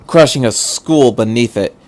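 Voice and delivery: usual speaking voice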